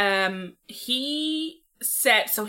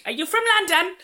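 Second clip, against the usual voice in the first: mock London lad; 8 semitones higher than usual. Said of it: "mocking the London lad".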